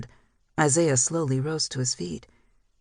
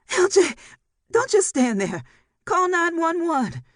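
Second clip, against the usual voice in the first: very high, loud frantic orders; panicked scream; 4 dB louder than usual. A narrator's reading. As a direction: scream at her son in panic. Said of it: "Mama Ginger's panicked scream".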